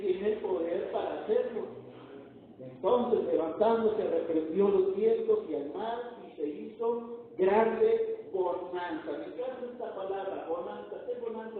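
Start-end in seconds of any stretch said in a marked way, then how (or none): 2.6-9.1 loud, ringing preacher's proclamation; full-force preaching. Acted. Preacher booming the sermon point to the congregation. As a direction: proclaim it to the whole church.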